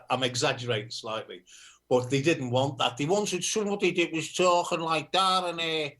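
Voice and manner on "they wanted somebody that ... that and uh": broad Liverpool Scouse accent, pitched higher; heavy Scouse for a laugh; thick Scouse accent. Shown as a demonstration. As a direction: lay on the Scouse accent hard. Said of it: "even I can't understand what I'm saying".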